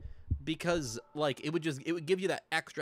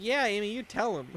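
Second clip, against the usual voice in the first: higher, louder cheer; cheering along; 8 semitones higher, 4 dB louder than usual. Retold or imitated. Gamer cheers Amy on for yelling at Sonic, loud and high. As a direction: egg her on like a fan. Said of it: "And I'm like".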